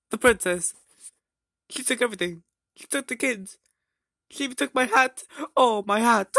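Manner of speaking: high-pitched tearful wail; crying. Acted. Mario mourns the princess and his hat, crying. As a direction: wail it out in a hammed-up sob. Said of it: "why are you crying?".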